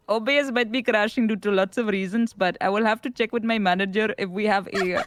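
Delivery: put-on tech support call in an Indian accent; chirpy helpline agent, Indian accent. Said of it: "why am I so good at the Indian accent?".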